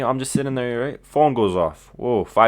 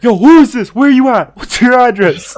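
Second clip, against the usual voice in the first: much higher, loud, furious shouting; retold furious yell; 13 semitones higher, 8 dB louder than usual. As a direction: blow up at a prank caller. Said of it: "this guy loses his top".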